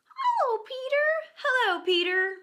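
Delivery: put-on very high, eager child's call; high happy boy; excited greeting. A narrator's reading. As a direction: shout hello happily. Boys greet Peter.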